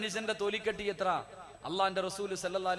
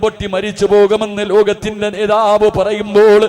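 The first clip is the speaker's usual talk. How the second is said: loud, chanting sermon cry with long-drawn endings; impassioned preaching; 4 semitones higher, 22 dB louder than usual. Acted. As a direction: preach at full force, stretching the last word of each phrase. Preaches at full force. (ഉച്ചത്തിൽ ഈണത്തിലുള്ള വികാരഭരിത പ്രസംഗം)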